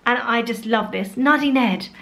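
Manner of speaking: ordinary delivery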